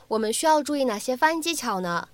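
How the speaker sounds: ordinary talk, nothing marked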